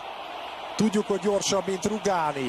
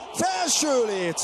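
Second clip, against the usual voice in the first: high, slow shout; crossbar exclamation; 8 semitones higher, slower than usual. Real, not acted. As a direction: cry out at the near miss. Shot hits the crossbar.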